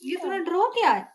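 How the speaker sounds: amazed she drew this; surprised. Real, not acted. Mother amazed at the drawing.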